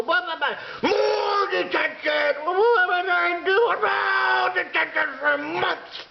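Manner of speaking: high, mocking shouted rant; mock teacher rant. Kid mocking the teacher's detentions, high shouted rant. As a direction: ham up the teacher's endless scolding. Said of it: "And you'd go".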